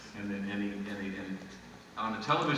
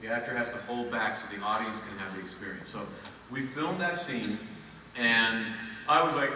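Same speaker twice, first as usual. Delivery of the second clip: ordinary delivery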